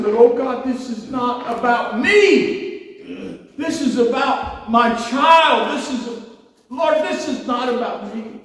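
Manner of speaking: high, loud, slow anguished cry; retold anguished cry to God, high and loud; desperate plea. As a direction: cry out to God, insisting it's about the child. Pleading with God.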